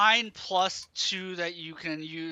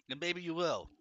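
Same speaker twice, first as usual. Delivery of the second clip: ordinary talk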